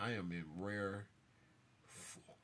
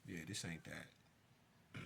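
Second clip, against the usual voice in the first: quiet and slow; 7 semitones lower, 11 dB quieter, much slower than usual. Real, not acted. Dismissive aside after the rant.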